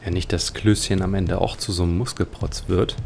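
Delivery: usual speaking voice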